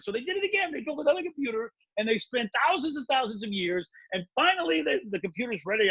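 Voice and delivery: ordinary delivery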